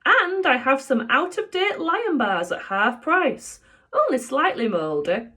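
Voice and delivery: high, lightly voiced shopkeeper; a hint of the cheery shopkeeper Raj. Reader voicing Raj selling old sweets, light shopkeeper voice.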